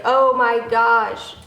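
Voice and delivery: loud, drawn-out, hammed-up exclamation; mock exclamation. Pretend greeting on walking over, mock amazement. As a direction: stretch every word in exaggerated amazement.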